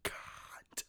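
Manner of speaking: slow, exaggerated voiceless curse; drawn-out whispered insult; mouthed whisper. Shown as a demonstration. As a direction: whisper it as if for a lip reader. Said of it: "like, lip readers".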